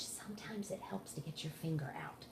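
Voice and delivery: soft whispered advice; whispering. Real, not acted. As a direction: lean in and whisper. Teacher whispers a tip.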